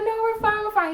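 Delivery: squeaky polite we're fine; squeaky high reply. Retold or imitated. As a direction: chirp it back sweetly. Quoting themselves to Rob, squeaky.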